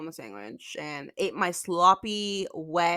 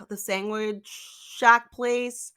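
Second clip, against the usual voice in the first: ordinary delivery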